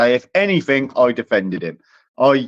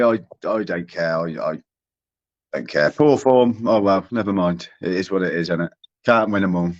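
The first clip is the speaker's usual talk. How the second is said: dejected resignation; 9 semitones lower than usual. Real, not acted. Dejected after accusation.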